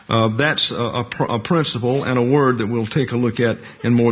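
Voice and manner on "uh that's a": normal talking voice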